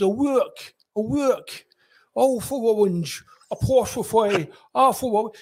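"O'Rourke" said "Owourke", "orange" as "owange"; Bobby spelling down the phone, R's as W's; can't say his R's. Retold or imitated. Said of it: "he couldn't pronounce his R's".

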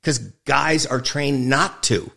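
normal talking voice

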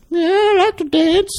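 put-on high, slow little old lady voice. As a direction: squeak it out like a frail old woman. Old woman voiced in retold trailer.

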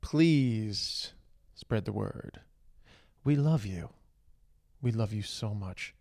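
ordinary talk

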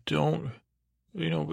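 ordinary talk, nothing marked

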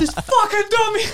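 high, drawn-out outburst; explosive retold yell. Husband blowing up at the lady in line, high retold yell. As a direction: explode in mock exasperation, pitched right up. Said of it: "So now I explode".

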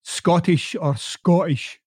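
"Scottish" said slowly in a broad Glasgow accent; Glasgow pronunciation. Shown as a demonstration. Host showing listeners how Glasgow says "Scottish", slow broad. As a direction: drag out "Scottish" the way they say it in Glasgow. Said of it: "I even call it Scottish now, when it really it's".

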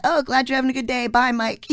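loud, high, breezy mock goodbye; offhand brush-off. Customers brushing off the clerk, loud breezy mock goodbye. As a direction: toss it off without listening. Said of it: "all these other people".

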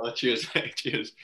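laughing through the words, high and loud; can barely get it out for laughing. Real, not acted. Laughing as he retells his teammate's flat-pitch remark.

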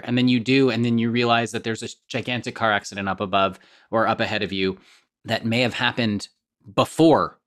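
normal talking voice

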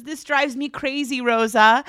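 high-pitched imitation of a fed-up employer; mock exasperation. Imitating Mary complaining to housekeeper Rosa, mock exasperated.

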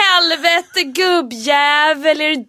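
loud, slow, drawn-out Hälsinge yell; Hälsinge dialect shout. Shown as a demonstration. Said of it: "har du hört dig själv?". (hälsingemål, högt och långsamt)

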